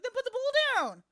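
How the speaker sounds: snapping. Retold or imitated. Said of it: "it's like".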